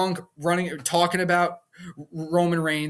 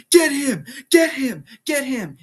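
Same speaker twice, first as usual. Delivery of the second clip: high, punchy repeated egging-on; mock urging; 9 semitones higher, 5 dB louder than usual. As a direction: imitate a wrestler goading his ally. Wrestler egging on another, acted.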